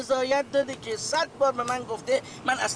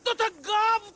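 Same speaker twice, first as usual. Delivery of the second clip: angry shout; 7 semitones higher, slower than usual. Acted. Old man scolds driver in street.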